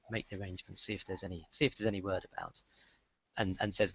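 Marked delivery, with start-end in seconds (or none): 0.1-2.6 hushed instruction. Acted. Priest quietly instructing his robed assistant, hushed. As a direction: murmur it so the crowd can't hear. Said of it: "you hear him kind of say".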